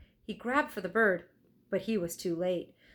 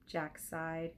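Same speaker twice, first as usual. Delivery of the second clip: ordinary delivery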